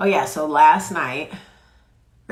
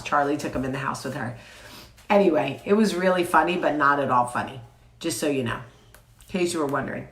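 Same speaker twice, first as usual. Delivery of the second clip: ordinary talk, nothing marked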